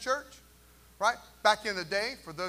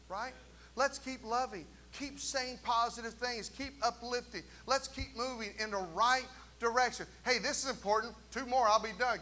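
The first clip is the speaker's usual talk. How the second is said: ordinary delivery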